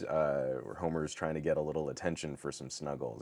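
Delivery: usual speaking voice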